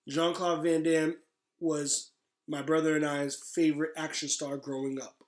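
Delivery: normal talking voice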